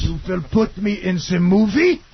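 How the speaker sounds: mock German accent.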